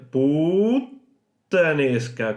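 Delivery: "Puttanesca" very slow, the T held, then released; exaggerated held T. Shown as a demonstration. Teacher exaggerating the double T for learners. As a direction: hold the T in "Puttanesca" long and let it go. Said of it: "retener la T allí y luego soltarla".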